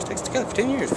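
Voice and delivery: squeaky high pitched retake; high silly voice. Shown as a demonstration. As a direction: jump way up in pitch. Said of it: "retake after ugh".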